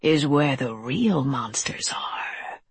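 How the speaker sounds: slow, breathy creature whisper; menacing whisper. A narrator's reading. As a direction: let the threat creep out slowly.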